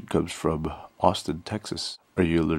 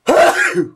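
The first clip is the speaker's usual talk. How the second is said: sharp explosive sneeze; loud sudden sneeze; violent sneeze; 12 dB louder than usual. Real, not acted. Sneezing test with eyes open.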